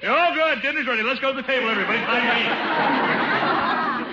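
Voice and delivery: loud high call to everyone; yelling across the room. Acted. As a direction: call the whole house to dinner. Calling everyone to dinner.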